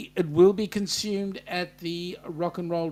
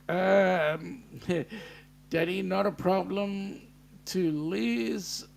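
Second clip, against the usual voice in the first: usual speaking voice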